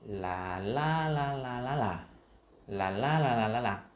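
"la la la" sung out with a rise and swell on the stressed beat; musical stress pattern. Shown as a demonstration. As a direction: chant "la" syllables like music, lifting the accented ones. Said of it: "giống như trong âm nhạc".

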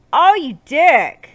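loud, high, slow insult; indignant shout. Real, not acted. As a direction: yell at whoever took it. Candle taken away.

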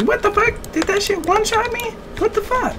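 very high, squeaky stand-in for a stunned player; mock incredulous squeal. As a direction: squeak it out in outraged disbelief. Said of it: "He was like".

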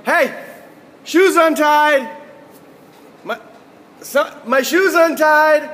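loud, high, slow yelling of "shoes untied"; whiny yelling. Shown as a demonstration. Teacher demonstrating the bad way, loud slow whiny yell. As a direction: bellow "shoes untied" at the room, drawn out and demanding. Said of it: "walk around going".

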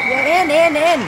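loud high urging; will the puck into the net; excited yelling. Real, not acted.

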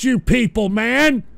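ordinary talk

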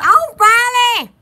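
high, loud, drawn-out greeting; imitating Brittany's greeting. Retelling Brittany greeting Riley, loud drawn-out call. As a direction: call the name out long and high. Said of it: "And Brittany said".

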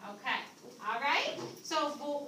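ordinary talk

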